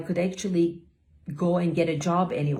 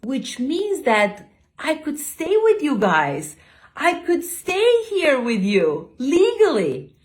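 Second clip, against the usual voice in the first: slow, high, loud happy announcement; excited explaining; 8 semitones higher, 6 dB louder than usual. Retold or imitated. Au pair telling kids she can stay, excited. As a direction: stretch it out with rising excitement.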